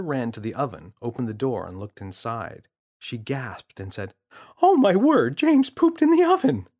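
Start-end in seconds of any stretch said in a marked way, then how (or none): 4.6-6.7 much higher and louder outburst; mother shrieking at the discovery; shocked mother. Acted. Mother finds poop.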